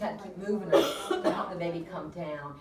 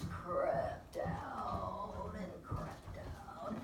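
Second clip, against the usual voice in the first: slow and soft; 10 dB quieter, much slower than usual. Real, not acted. Slow birth described.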